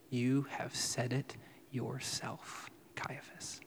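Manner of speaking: slow, dropping into a whisper on Caiaphas; a calm, whispered answer to the priest; whispered reply. Retold or imitated. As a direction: say it slowly, then breathe his name. Jesus answering the high priest.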